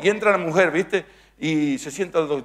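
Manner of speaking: ordinary delivery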